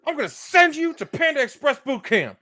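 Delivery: very high, strained comic threat; mock threatening recruiter. Parody recruiter threatening boot camp, high mock threat. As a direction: threaten them in a high, put-on voice.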